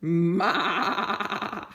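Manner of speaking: goat bleat. Acted. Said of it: "said Goat".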